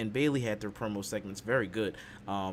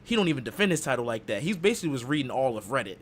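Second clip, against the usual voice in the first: ordinary talk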